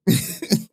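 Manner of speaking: silent high wheeze of laughter; cracking up without voice; wheezing laugh. Real, not acted. Laughing at chat messages.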